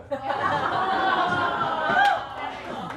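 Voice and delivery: high, drawn-out falsetto laugh; real high-pitched laughing fit. Real, not acted. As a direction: let the laugh soar high.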